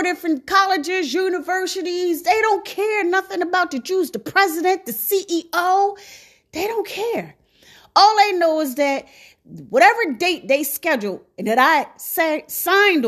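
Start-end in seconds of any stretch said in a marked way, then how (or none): none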